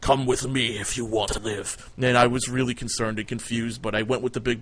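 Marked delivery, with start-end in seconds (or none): 0.0-1.9 slow, deadpan command from the time traveller; a light take on an action-movie order; Terminator-style line. Retold or imitated. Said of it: "And he said".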